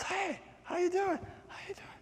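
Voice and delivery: tiny, very high, soft voice; raindrop's greeting. Acted. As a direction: squeak a quiet hello to friends. Raindrop greets friends in the lake.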